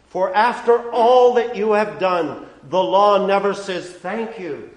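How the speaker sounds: loud, rising pulpit preaching; impassioned preacher; full-force preaching. Acted. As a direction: drive the law's demands home from the pulpit. Preaches the law's demands.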